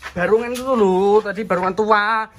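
hammed-up panic; panicked. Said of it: "Kamu panggilnya panik-panik".

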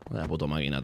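ordinary delivery

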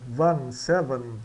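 "van, seven" slowly, the A of "van" open and English; teacher modelling "van"; slow pronunciation demo. Shown as a demonstration. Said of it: "soa como van".

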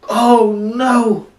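high, loud cry of dismay; horrified cry. Acted. Sailors beg God's forgiveness, horrified cry. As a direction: cry out in acted horror. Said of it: "cried the sailors".

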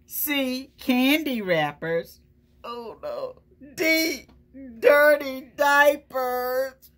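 lightly voiced hungry truck savouring its menu; greedy truck. Truck reciting its recipe to the kids, lightly relishing. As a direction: relish each gross item like a treat. Truck lists its alphabet soup.

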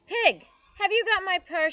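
high, loud kitten's call; calling over noise. A narrator's reading. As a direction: call out over the piglets' snuffling. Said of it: "she called over the".

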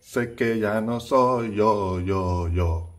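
slow lyric with drawn-out yo; slow teaching read. Shown as a demonstration. Teacher reading the lyric slowly to students, drawing out yo. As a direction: stretch the yo like the song. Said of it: "我知道不是我".

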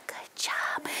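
soft whisper. Shown as a demonstration. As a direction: whisper it to calm them. Hand rhyme quiet ending.

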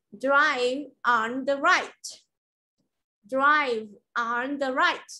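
slow Drive on the right; teacher models the sentence again for the class; model pronunciation. Shown as a demonstration. Teacher models the sentence slowly.

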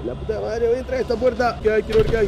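high-pitched, worked-up resolve; psyching himself up. Real, not acted. Gamer swearing he will enter the scary door, worked up. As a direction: push the voice up high, working up nerve.

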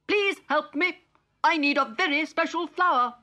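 high, fast little robot pleading; Digby pleading. Acted. Digby asks Polly for a special flower, high and hurried. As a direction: rush the plea up high like an anxious little robot. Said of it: "he cried".

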